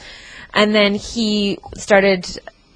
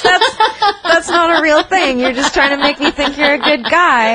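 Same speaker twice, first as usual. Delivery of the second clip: laughing; 7 semitones higher than usual. Retold or imitated. Calling out the scammer, laughing.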